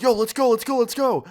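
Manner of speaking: high, loud, re-enacted panic; panicked urging. Passenger urges friend to flee the knife, panicked. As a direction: urge the driver to get moving now. Fleeing a knife attack in car.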